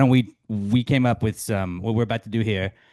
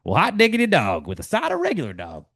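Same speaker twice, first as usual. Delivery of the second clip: higher, excited folksy exclamation; Ted Lasso catchphrase; 8 semitones higher than usual. Acted. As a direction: whoop it like a delighted coach.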